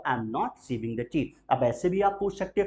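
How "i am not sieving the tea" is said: very slow, fading softer; slow soft negative. Shown as a demonstration. Teacher drills the negative answer, slow and fading. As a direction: draw the not-answer out gently.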